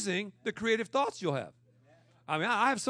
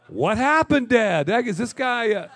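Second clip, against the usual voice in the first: put-on puzzled kid; 3 semitones higher, 11 dB louder than usual. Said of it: "conferences with my father".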